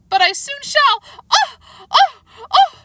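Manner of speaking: high, loud wail breaking into shrieks; screaming. A narrator's reading. The Queen dreads pricking her finger, shrieking. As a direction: shriek it, ending in screamed ohs.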